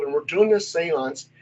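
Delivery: ordinary delivery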